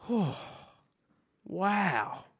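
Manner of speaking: breathy gasp, then a quiet wow; taken aback by the sight, losing a bit of breath; awestruck. Acted. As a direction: let a soft wow out in awe. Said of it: "You kind of lose a bit of breath".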